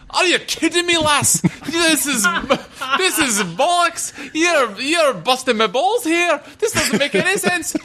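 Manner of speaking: very high, hammed-up Scottish accent; indignant Scottish squawk, played for laughs; mock Scottish Cable. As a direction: rant in a silly high Scottish voice. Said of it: "everything in a Scottish accent".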